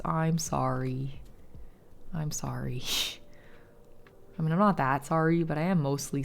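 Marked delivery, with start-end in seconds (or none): 0.0-1.2 soft, slow sorry; quiet apology. Real, not acted. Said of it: "tell to be quiet in a public space". 2.1-2.8 whispered sorry, barely voiced; whispered apology. Real, not acted. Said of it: "tell to be quiet in a public space".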